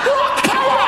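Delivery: a huge, high rock-star scream; mock rock shout. Singer screaming at the festival crowd, high rock yell. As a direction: hype the crowd like a rock god.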